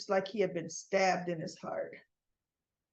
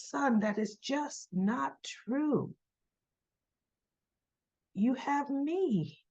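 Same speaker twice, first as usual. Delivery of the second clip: put-on consoling old father; 6 semitones higher, 4 dB quieter, much slower than usual. Victor consoling grieving son Adam.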